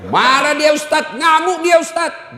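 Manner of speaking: mock complaint to the preacher; complaining husband. Congregant voiced complaining about wife. (mengadu dengan keras)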